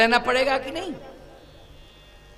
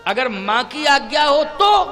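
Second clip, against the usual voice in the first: high, loud rising condition; emphatic repeat; 5 semitones higher, 5 dB louder than usual. Acted. Ram puts the condition to his mother, loud and emphatic. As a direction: lift and hold "तो" at the end.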